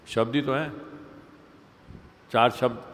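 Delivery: usual speaking voice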